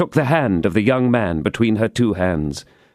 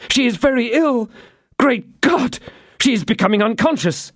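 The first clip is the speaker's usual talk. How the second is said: panicked; 13 semitones higher than usual. Acted. D'Artagnan panics.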